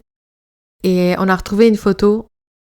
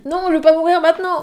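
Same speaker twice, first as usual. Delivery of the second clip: higher, strained voice after coughing; choking on a drink; 8 semitones higher than usual. Real, not acted. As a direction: speak through a choking throat. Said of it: "Je m'étouffe avec mon thé".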